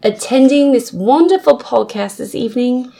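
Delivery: grandly emphatic mock British sign-off, pitch climbing; hammed-up British accent, rising into laughter; put-on British accent. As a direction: play a gracious British host, slowing at the end. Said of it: "Give me a British accent".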